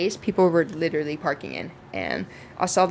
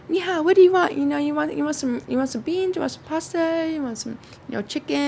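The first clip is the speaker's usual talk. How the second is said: high, bubbly hostess offering food; eager hostess; 8 semitones higher than usual. Retold or imitated. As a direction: play the eager host piling on dishes. Voicing hostess Barbara offering food.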